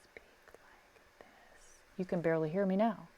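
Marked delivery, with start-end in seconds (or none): 0.0-1.8 soft unvoiced whisper, slowed; ASMR-style whisper demo. Shown as a demonstration. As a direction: breathe the words out barely audible. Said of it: "is where people speak like".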